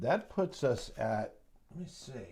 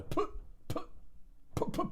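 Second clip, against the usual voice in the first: high mock putt-putt of an engine dying; put-put like a car running out of gas; sputtering car noise. Trader mimicking a stock sputtering like a car, mock engine noise.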